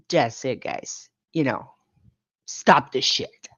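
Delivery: low, firm, fed-up voice; stern. Retold or imitated. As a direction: shut it down, serious and fed up. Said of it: "you can tell in your voice".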